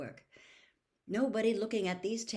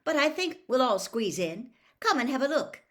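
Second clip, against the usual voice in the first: louder and higher, called from inside a tent; calling out; 6 semitones higher, 7 dB louder than usual. A narrator's reading. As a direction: call out to the kids outside. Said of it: "he called".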